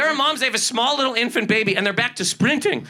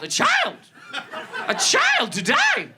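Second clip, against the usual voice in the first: much higher incredulous cry, slow at the end; exclaiming in disbelief at adults; outraged disbelief; 13 semitones higher, much slower than usual. Real, not acted. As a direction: stress how absurd it is. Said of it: "Grown adults tweeted at a child".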